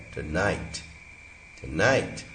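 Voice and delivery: "tonight" with a clear weak vowel between t and n; full-form pronunciation. Shown as a demonstration. Teacher showing students the full word, careful pronunciation. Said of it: "इस पे हमने stress दे दिया".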